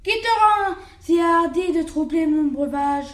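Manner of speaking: loud furious snarl at the lamb; the wolf roars at the lamb; enraged wolf. Acted. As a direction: bellow it like a raging wolf. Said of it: "dit cet animal plein de rage".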